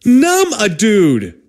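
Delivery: high, slow, pointed demand; exasperated scolding. Pretend. Patient addressing the absent doctor, exasperated. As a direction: drag out each word as obvious advice.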